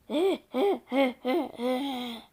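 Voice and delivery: put-on dry, cracked old man's cackle. A narrator's reading. Boy narrator cackles like an old man. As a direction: let a creepy old man's cackle escape. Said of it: "an old man's cackle".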